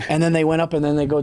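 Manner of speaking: ordinary delivery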